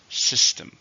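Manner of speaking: system with "sys-" stressed, spoken crisply; teacher models system, weight on "sys-"; stress on the first syllable. Shown as a demonstration. Model the word for students, stress on "sys-". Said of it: "stress on the first".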